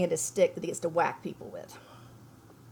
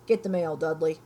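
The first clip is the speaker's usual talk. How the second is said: louder gruff command, a touch of bark; father ordering his son about, louder and gruff; 4 semitones lower, 5 dB louder than usual. Acted. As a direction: bark it from behind the paper. Uncle Vernon orders Dudley.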